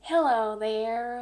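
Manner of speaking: slow, lightly drawn-out Texas greeting; a hint of a Texan saying hello; Texas accent. Shown as a demonstration. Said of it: "if you go to Texas".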